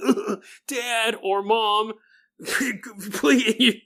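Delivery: pleading through a fake cough; imitating coughing kids. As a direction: play a kid choking on smoke, slowly begging. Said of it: "your kids start coughing".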